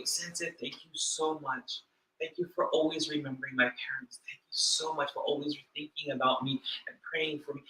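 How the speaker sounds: soft, low, gently grateful quote; kind, sincere thanks. Retold or imitated. Churchgoer humbly thanking her sensei, kind and sincere. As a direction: lightly voice a sincere thank-you, hushed. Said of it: "she was so kind, so sincere".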